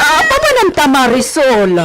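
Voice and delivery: loud, high call; urgent shout. Acted. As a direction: hurry her to safety. (madaliang sigaw)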